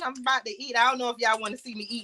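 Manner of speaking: ordinary delivery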